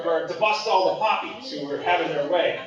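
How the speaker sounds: ordinary talk, nothing marked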